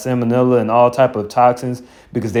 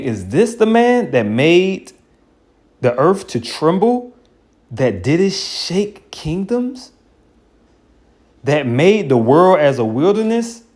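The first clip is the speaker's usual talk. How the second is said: higher, slow, drawn-out question; incredulous scripture reading; 6 semitones higher, slower than usual. Acted. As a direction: savor each phrase in disbelief. Reading scripture with mocking wonder.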